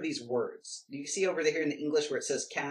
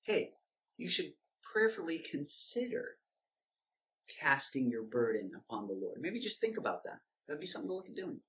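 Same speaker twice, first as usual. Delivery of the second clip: water it down into a timid hint; mock wishy-washy suggestion; 6 dB quieter than usual. Shown as a demonstration. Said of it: "Doesn't say that".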